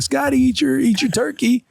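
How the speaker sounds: high-pitched parent talking to a little one; coaxing parent. Retold or imitated. Dad turns to Scotty during Rue's meltdown, high coaxing voice.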